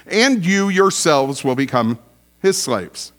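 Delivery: usual speaking voice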